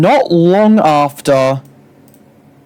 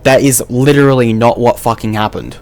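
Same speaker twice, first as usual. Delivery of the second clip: ordinary delivery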